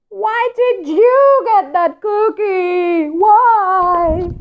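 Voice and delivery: very high, loud, slow wailing demand; hysterical. Acted. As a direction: throw a crying tantrum. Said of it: "He's going hysterical".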